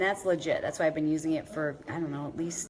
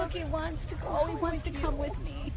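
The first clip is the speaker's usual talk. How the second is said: high, soft cooing voice; 10 semitones higher, 5 dB quieter than usual. Real, not acted. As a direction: coo at the dog sweetly. Cooing about the dog.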